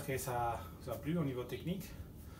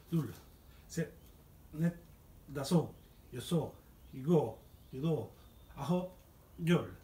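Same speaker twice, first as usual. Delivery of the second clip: coach counting; 2 semitones higher, slower than usual. Acted. Coach counting push-ups in Korean.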